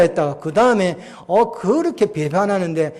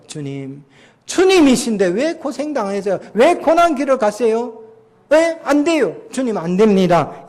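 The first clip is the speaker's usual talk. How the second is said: rising, louder pleading with each question; pleading protest; 6 semitones higher, 5 dB louder than usual. Retold or imitated. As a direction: argue desperately against the Lord. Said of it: "항변이란 건 ... 논리를 펴는 거예요".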